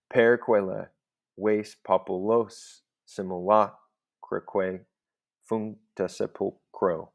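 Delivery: slow, beat-by-beat "Per quella ves populos simulacraque functa sepulchro"; metrical scansion reading. Shown as a demonstration. Teacher checking the six feet aloud, metrical scansion reading. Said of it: "So we have the, the B to it".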